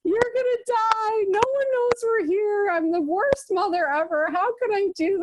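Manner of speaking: high, panicky cry of doom, rising to a near-squeal; panicked wail. Retold or imitated. Mother stuck on rocks wailing to her kids, high panicked. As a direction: wail it like you're stuck on the rocks and sure you'll die. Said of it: "And I'm like".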